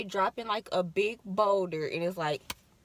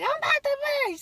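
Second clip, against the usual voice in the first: high urgent cry; urgent yell; 4 dB louder, faster than usual. Retold or imitated. Warning bickering nurses, high urgent cry. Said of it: "I'm like".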